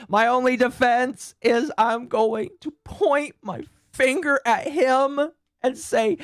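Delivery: high, slow whimpering victim act; mock whiny victim. Streamer mocks the British rival as a whining victim, high and slow. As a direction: play the pitiful victim, drawing each word out. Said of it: "he makes it sound like he's such a victim".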